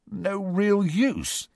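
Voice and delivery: slow, strained disbelief. A narrator's reading. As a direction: squeeze the words out tightly. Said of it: "said Ron in a strained voice".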